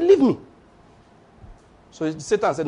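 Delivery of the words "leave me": high, sharp brush-off; irritated Mary. Acted. Mary dismissing Satan's nagging, high and sharp. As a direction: snap her off.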